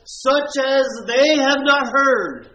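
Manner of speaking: preacher driving home the verse; slow, preached full force. Shown as a demonstration. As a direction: let each word land like a verdict. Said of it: "he says".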